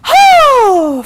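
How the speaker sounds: sudden high loud cry of release; sigh turned cry. Retold or imitated. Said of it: "I just had her sigh".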